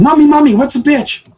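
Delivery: put-on high, eager little-kid question; little boy voice. Little Johnny running over to mom, high child voice. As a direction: pipe up like a curious child.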